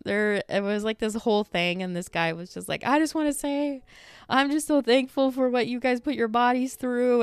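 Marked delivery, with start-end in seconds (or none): 2.8-7.2 raised-pitch impassioned mock speech; emotional fan imitation. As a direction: imitate a fan getting choked up. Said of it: "he's starting to get emotional".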